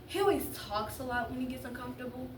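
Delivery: plain reading voice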